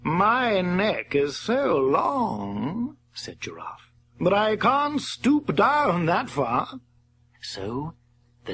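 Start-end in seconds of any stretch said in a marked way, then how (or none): 0.0-6.8 very slow whiny giraffe; drawn-out lazy excuse. Acted. Giraffe character voice.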